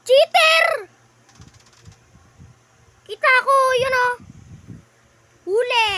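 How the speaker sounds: loud, high call-out; accusing shout. Real, not acted. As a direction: call out the cheater loudly. Calling out a cheater.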